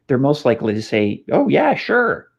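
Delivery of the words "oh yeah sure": high, slow, offhand yes; breezy waiter brushing it off; careless waiter. Retold or imitated. Waiter to diner about coffee, high breezy agreement.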